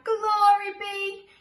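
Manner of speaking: put-on high flustered old lady; old lady crying out. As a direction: despair at the animals' mess. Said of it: "The little old lady cried".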